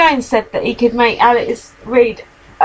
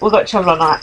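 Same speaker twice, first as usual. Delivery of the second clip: ordinary talk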